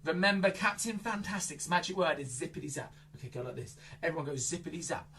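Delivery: ordinary talk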